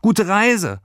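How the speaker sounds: high, loud farewell call. Acted. Lilli calling goodbye from the window, loud. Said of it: "rief sie noch".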